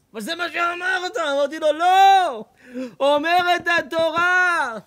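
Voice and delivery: high, loud back-and-forth, last words slow; heated shout. Retold or imitated. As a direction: cut him off with a loud no. Heated exchange.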